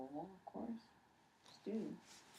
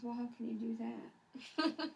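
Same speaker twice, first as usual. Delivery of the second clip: mock baffled; 7 semitones higher, 5 dB louder, much slower than usual. Mother retelling her bafflement.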